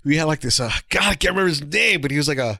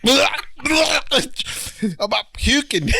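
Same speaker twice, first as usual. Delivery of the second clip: laughing; 10 semitones higher, much slower than usual. Real, not acted. Choking on gas, laughing.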